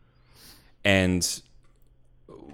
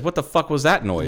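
mock alarmed, a bit louder; mock alarmed quote; 7 semitones higher, 5 dB louder than usual. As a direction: imitate a spooked friend, half-joking. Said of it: "You said".

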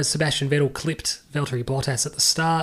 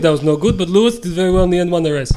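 loud, higher put-on Austrian boss voice; Niki Lauda impression; 5 semitones higher, 7 dB louder than usual. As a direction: bark it out like Niki Lauda with a heavy accent. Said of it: "I'll try to answer it as Niki Lauda".

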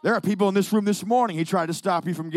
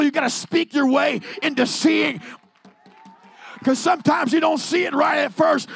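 fiery revival preacher crying freedom over the room; preaching at full force; 10 semitones higher, 4 dB louder than usual. Acted. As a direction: preach it with everything you have, building to the last line. Preaching climax on speaking faith.

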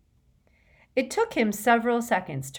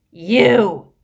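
higher, very loud bellow; 4 semitones higher, 14 dB louder than usual. A narrator's reading. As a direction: roar it out in accusation. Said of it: "he bellowed".

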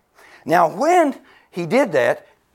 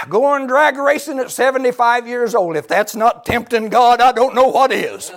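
mock scandalized sister, pitched up; imitating a disapproving sister; sister's scolding; 8 semitones higher, 5 dB louder than usual. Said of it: "And she says".

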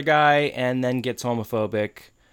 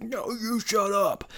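high, strained retort without real volume; mock scream; 11 semitones higher than usual. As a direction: escalate a pretend shouting match. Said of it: "some guy is screaming through the wall".

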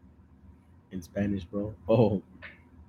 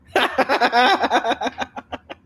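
loud, high laughing burst; big laugh; 10 dB louder than usual. Real, not acted. Reacting to own plaque joke, laughing hard. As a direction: crack up loudly.

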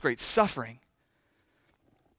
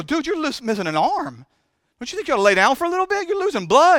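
much higher, fretful pleading; mock worried onlooker; 10 semitones higher, 4 dB louder than usual. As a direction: play the world begging you to rest, pitched way up. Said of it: "the world looks at us and go".

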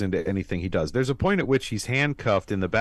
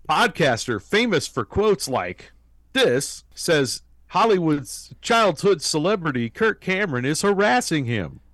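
higher, announcer-style tabloid read; a hammed-up breaking-news voice; mock news headline; 8 semitones higher than usual. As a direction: sell it like a sensational TV news anchor.

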